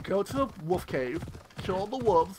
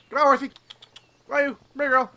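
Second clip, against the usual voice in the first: higher, sweet coaxing; coaxing horse; 6 semitones higher, 4 dB louder, faster than usual. Real, not acted. Player calls her horse over, coaxing. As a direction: coax the horse like a pet.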